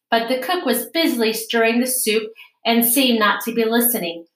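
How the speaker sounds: usual speaking voice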